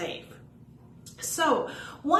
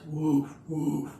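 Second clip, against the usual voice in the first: dog bark; 4 semitones lower than usual. Pretend. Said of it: "And he says".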